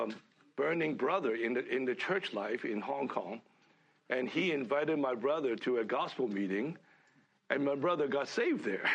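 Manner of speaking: normal talking voice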